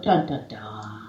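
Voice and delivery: low mock suspense sting; dramatic dun dun dun. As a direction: sing it like a spooky cue.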